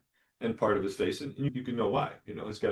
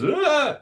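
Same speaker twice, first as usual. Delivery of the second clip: sudden high yelp, mock pain; imitated yelp of pain; 8 dB louder than usual. Kid flinching at the injection, a sharp yelp. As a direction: belt a sharp startled cry. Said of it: "And then he just".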